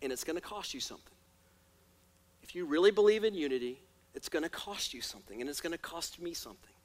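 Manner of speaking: quiet and sober. Acted. Sober soft reflection after peak.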